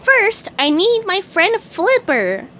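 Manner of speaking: very high, eager cartoon sponge voice; high squeaky sponge voice; SpongeBob voice. A narrator's reading. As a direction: chirp it like a keen little fry cook. SpongeBob speaks.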